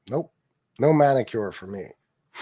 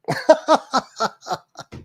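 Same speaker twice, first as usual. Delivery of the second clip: high laugh; 11 semitones higher, 4 dB louder than usual. Real, not acted. Laughing at own joke.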